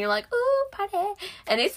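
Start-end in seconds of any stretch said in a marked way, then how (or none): 0.3-1.0 mock party cheer. Friend mimicking riders seeing the crazy lights, playfully excited. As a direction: pitch it way up like a playful little cheer.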